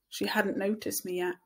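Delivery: ordinary delivery, nothing marked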